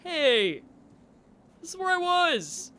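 mock sudden realization, shouted; retold flare of recognition. Recalling the heat of recognizing the place, excited shout. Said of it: "like a flare of".

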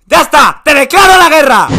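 loud, high declaration of war; furious shout. Acted. Fed-up country yells a declaration of war. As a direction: explode in rage.

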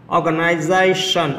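Organization slowed, with ZA stressed; stress on fourth syllable. Shown as a demonstration. Teacher demonstrating the rule to students, slow Organization stressed on ZA. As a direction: stretch the word and hit ZA in Organization. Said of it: "Số bốn".

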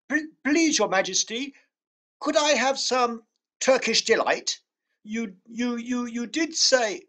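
put-on nervous boy, stumbling over words; timid stammer. Edmund timidly asks the Queen.

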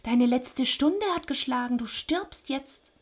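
high creature voice, slowing to finish; menacing Death. A narrator's reading. Death tells Sisyphos his hour has come, menacing. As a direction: pronounce doom high, drawing out the end. Furious Death pronounces the sentence.

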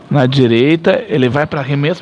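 steady match narration, low and even; live play-by-play. Acted. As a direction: call the action as it happens. Play-by-play before shot.

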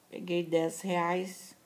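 ordinary talk

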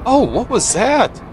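high, slow startled cry of confusion. Real, not acted. Gamer's truck suddenly jolts, startled high cry. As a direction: caught off guard, voice jumping way up.

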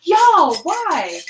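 high, loud exasperated cry; exasperated wail. Real, not acted. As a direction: throw up your hands and wail it. Wailing at own mistake.

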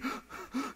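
high, soft gulping gasp; mimicked breathless crying gasp; imitated gasping. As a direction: gasp like a sobbing child who can't breathe. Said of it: "he can't breathe".

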